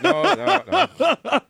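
high, put-on "no, no, no"; mock refusal. Recalling his reaction, high mock refusal. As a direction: recoil in mock horror. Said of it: "I was like".

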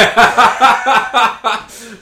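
high loud laugh; loud burst of laughter. Real, not acted. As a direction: laugh out hard. Laughs at Colts joke.

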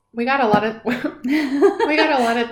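words around a sip of juice; talking while drinking. Real, not acted. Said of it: "I'm sorry, I'm drinking my juice".

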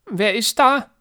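put-on high, loud old lady through door; old woman voice. A narrator's reading. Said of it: "klingt es durch die Tür".

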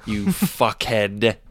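slow, drawn-out mock insult; John Malkovich. Host in impression insulting co-host, slow and deliberate. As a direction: draw out the insult with deliberate care. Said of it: "my John Malkovich impression".

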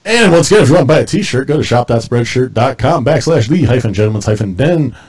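loud, pushy merch pitch; loud ad plug. Acted. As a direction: sell the shirt like a TV ad.

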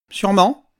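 put-on prim old lady's reluctant reply; stiff, pinched. A narrator's reading. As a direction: let it drop with pursed lips. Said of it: "lâcha-t-elle d'un air pincé".

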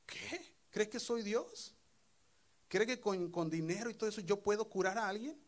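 high, alarmed disbelief; a frightened king protesting he is not God; startled alarm. Retold or imitated. King of Israel, frightened, protests to the envoy: high alarmed disbelief. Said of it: "Se asustó".

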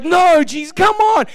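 high, loud exasperated plea; mock protest. Preacher protesting in prayer, high loud exasperated plea. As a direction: beg Jesus to make her go away. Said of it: "I was like".

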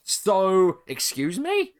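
high-pitched put-on disbelief; hammed-up indignant surprise; mock incredulous.

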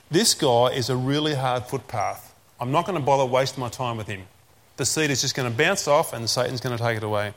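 low, dismissive verdict on someone; dismissive judging. Acted. Soil inspector writing off a hard case, low and dismissive. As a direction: write him off in a low voice.

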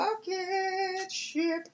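very high, very slow drawn-out voice; silly high voice. Pretend. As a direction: stretch it out in a goofy high squeak. Playful exclamation about Cid's rocket.

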